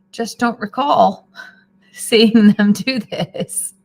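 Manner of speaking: slow, laughing through the words; drawn out with real laughter. Real, not acted. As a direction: chuckle while you say it. Amused at the chicks.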